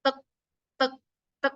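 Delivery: "tek, tek, tek" as evenly spaced taps; steady beat. Shown as a demonstration. Coach showing debaters the speaking rhythm, evenly spaced beats. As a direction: count out a metronome beat, one clipped syllable each. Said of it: "ritmenya itu dipertahankan dengan ketukan".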